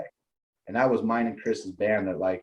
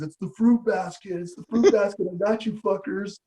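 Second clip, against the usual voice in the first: high, gleeful prankster taunt; sing-song gloat at your friends; mocking [name] voice; 11 semitones higher than usual. [name] pranking bandmates in van.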